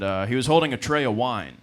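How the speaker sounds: ordinary delivery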